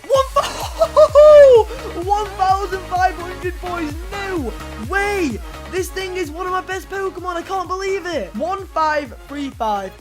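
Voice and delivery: overjoyed surprise. Real, not acted. Great evolution result.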